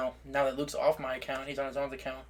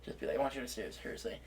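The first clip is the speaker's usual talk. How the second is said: usual speaking voice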